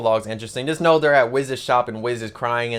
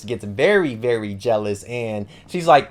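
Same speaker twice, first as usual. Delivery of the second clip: usual speaking voice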